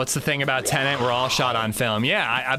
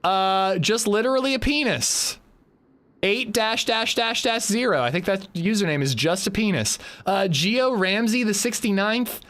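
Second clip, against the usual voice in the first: much higher, hyped shout-out; excited thanks; 9 semitones higher than usual. Real, not acted. Streamer thanking Spike Dude for gifted subs, excited and high. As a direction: whoop it up for the gifters.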